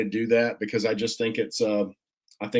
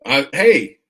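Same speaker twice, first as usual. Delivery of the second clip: ordinary delivery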